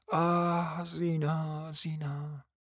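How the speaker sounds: soft, drawn-out appeal fading away; tender sigh. Acted. Mother pleading with her daughter Zina, softly sighing. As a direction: let the name fade out slowly, very softly. Character's long plea to Zina.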